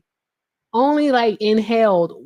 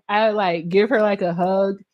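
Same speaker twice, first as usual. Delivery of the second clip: ordinary talk, nothing marked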